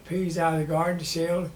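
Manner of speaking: ordinary talk, nothing marked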